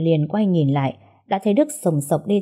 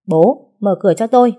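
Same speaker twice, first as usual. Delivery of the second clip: angry shout; 3 semitones higher, 7 dB louder than usual. Acted. Son-in-law banging at locked door.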